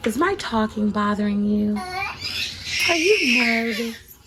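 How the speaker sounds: wife teasing her camera-shy husband; teasing baby talk. Pretend. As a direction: tease him like a shy child.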